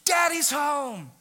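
put-on high, slow, loud child's shout of joy; little girls' cry. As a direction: call out like a small child greeting dad. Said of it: "here's what they say".

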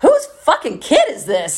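loud, high mock indignation; imitating an appalled rock star. Rock star at an after-hours party, loud and appalled. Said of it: "[name] from the Foo Fighters was like".

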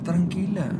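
soft, soothing brush-off to an angry wife; mock serene calm. Shown as a demonstration. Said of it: "pasa igual con tu esposa".

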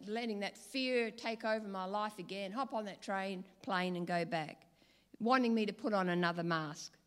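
ordinary talk

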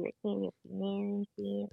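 quick, very soft remark; barely audible. Real, not acted. Partner on a phone call, too soft to hear. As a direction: say it fast and very softly. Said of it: "Sorry, I can't hear you".

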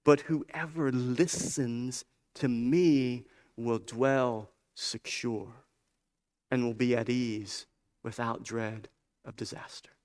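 slow right down and let each phrase settle; hushed, slow promise. Acted. Preacher reading Wisdom's promise to whoever listens, hushed and slow.

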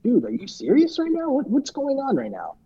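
high-pitched mock outrage. Josh protesting his disqualification, high mock disbelief. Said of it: "He's like".

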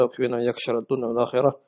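usual speaking voice

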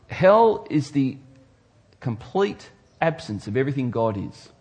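ordinary talk, nothing marked

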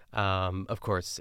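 ordinary delivery

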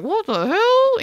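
high, incredulous cry; shocked disbelief. Retold or imitated. Protagonist in the cab, retold as a high shocked cry. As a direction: gasp out the disbelief. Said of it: "is just like".

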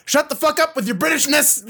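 Whoopi Goldberg. Retold or imitated. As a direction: cut off the phony accent impatiently. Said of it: "doesn't Whoopi Goldberg, she's just like".

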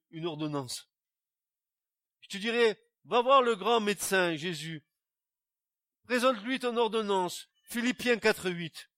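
fiery preaching. Acted. Preacher urging the congregation toward Jesus, loud fiery preaching. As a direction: proclaim it to the whole church.

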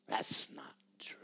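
slow whisper of a taunting demon. Retold or imitated. Preacher voicing the demon's taunt to the church, whispered. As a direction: whisper it like a voice in your head. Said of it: "I hear 'em taunting".

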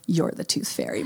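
soft, half-whispered imitation of a kid; hushed, low-volume reveal; hushed knowing child. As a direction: voice the son quietly catching mom out. Son quietly calls out mom's secret.

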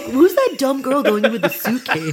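mocking onlooker voice.

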